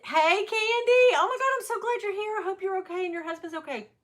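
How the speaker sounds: high, gushing hello to a friend; light up on seeing her; excited greeting. Real, not acted. Spots a friend in the chat.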